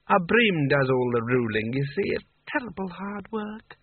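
put-on slow, slurred old sultan; a tipsy, weary old ruler; drunk sultan voice. A narrator's reading.

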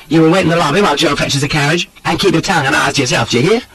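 high, rapid scolding; brisk, bossy woman's voice; sharp. A narrator's reading. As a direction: order a companion about sharply. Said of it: "says Annette sharply".

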